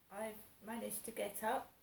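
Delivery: normal talking voice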